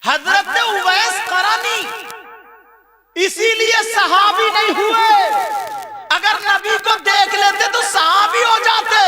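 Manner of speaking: loud, high, drawn-out sermon cry; preaching at full force. Acted. Preacher crying out the saint's story to the crowd, full force. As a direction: pour it out to the congregation.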